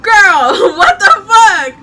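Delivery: loud, high shout breaking into laughter; shouting at the note in shock; shocked laughing shout. Retold or imitated. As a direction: yell it in disbelief. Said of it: "And I'm like".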